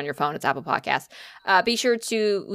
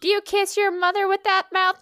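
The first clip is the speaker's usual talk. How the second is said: high-pitched put-on scolding voice; TikTok scold; 11 semitones higher than usual. TikTok character scolds someone for cursing, high mock reproach. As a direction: imitate a prim telling-off, pitched up. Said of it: "I saw a TikTok that was like".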